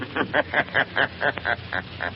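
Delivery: mocking laugh. The Shadow reveals himself with a laugh.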